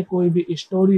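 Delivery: ordinary talk, nothing marked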